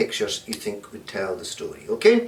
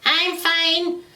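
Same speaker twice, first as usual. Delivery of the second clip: put-on very high falsetto old-lady voice; a wolf squeaking like a granny; wolf in disguise answering the girl; wolf playing Granny. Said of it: "said the big bad wolf, pretending to be dressed as Granny".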